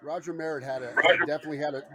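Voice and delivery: ordinary talk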